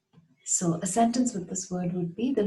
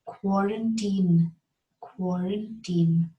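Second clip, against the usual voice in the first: slow, clearly spelled-out quarantine; slow teacher model of quarantine; slow model word; much slower than usual. Shown as a demonstration. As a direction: say it very slowly so students can copy. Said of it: "we should know how to say it right".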